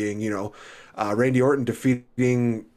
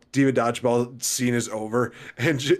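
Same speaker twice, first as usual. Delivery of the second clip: ordinary delivery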